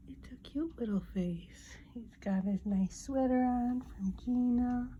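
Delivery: hushed whisper so he stays asleep. Real, not acted. Whispering to the bird beside sleeping Uncle Bert.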